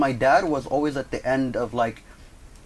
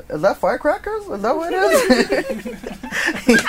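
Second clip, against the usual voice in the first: very high, feigned cluelessness; exaggerated innocent questioning; playing dumb; 4 dB louder, much slower than usual. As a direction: ask it like you have no idea, breaking into laughter. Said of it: "And, like, playing dumb".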